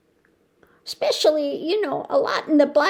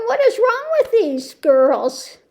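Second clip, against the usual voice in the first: higher, exasperated cry; mock outrage, pitched up; shocked disbelief; 6 semitones higher, 4 dB louder than usual. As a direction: throw up your hands at these girls. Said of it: "So you're going".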